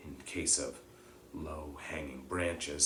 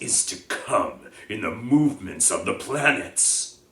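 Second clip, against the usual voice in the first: loud, solemn reproach; stern warning; 9 dB louder than usual. Acted. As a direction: Bane reminding Firenze of their oath, louder. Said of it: "growled Bane".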